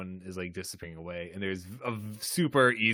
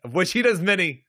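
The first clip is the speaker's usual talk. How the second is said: higher, louder insistence; emphatic repeat; 8 semitones higher, 6 dB louder than usual. Real, not acted. Co-host insists Data uses many contractions, emphatic and loud. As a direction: punch it up loud and high.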